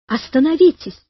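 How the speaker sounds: urgent high-pitched appeal; shouting plea. A narrator's reading. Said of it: "кричал Карик".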